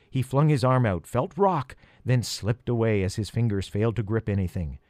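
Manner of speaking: plain reading voice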